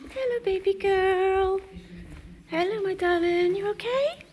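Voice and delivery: soft, high-pitched coo; tender soothing. Real, not acted. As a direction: softly reassure a mare in labour. Soothing mare whose waters broke.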